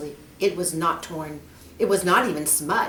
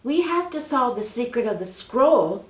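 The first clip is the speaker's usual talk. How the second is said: put-on higher, louder hopeful girl; girl Mary; 6 semitones higher, 5 dB louder than usual. Mary asks the snake for help, eager. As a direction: ask sweetly and eagerly.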